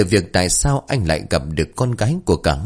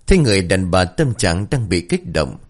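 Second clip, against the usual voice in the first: ordinary delivery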